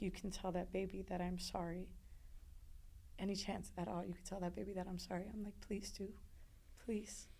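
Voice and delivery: soft, pleading prayer; small, soft begging; hushed prayer. Retold or imitated. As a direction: plead quietly with God, barely raising the voice. Retells grieving prayer to the Lord.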